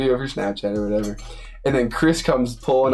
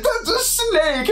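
mock squeal, very high and slow; squeaky panic; much slower than usual. Teammate spotting the snake: very high, slow squeak. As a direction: drag it out way up in a frightened squeak. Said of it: "screams in a pitch that's, like, whistle tone".

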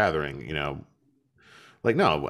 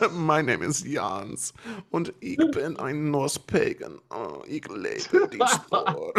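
slow, hammed-up German accent, pitched up; mock German accent; 7 semitones higher, slower than usual. Joking to co-hosts in a slow, cheesy German accent. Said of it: "I can't hear you do that".

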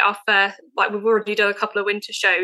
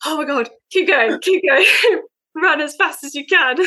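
high, half-laughing plea to keep moving; mock desperate urging; 6 semitones higher, 4 dB louder than usual. Runner out of breath coaxing her dog, mock desperate urging. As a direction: imitate an exhausted runner egging on the dog. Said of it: "more out of breath running her".

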